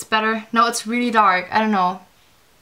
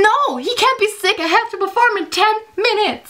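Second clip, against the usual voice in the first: high, frantic pleading, much higher in pitch; panicked; 10 semitones higher than usual. Retold or imitated. Retelling her own panic ten minutes before singing, high and frantic. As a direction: panic rising as the clock runs out. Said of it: "I was freaking out".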